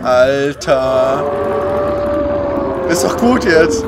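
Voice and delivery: exasperated shouting. Real, not acted. Fighting monster in game.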